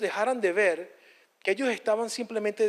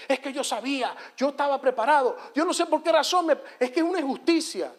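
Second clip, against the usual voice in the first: upset excuse; 7 semitones higher than usual. Retold or imitated. A person who failed the exam protesting to the preacher, indignant and rising. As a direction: sputter the indignant excuse, climbing as it goes.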